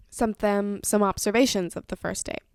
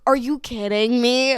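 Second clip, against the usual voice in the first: disbelieving; 2 semitones higher, 9 dB louder, slower than usual. Retold or imitated. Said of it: "I was like".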